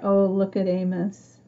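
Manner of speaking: ordinary delivery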